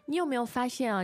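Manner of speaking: ordinary talk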